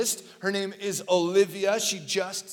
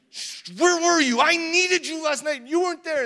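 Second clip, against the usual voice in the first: put-on whiny hurt child; 9 semitones higher, 5 dB louder than usual. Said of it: "then she just started to cry".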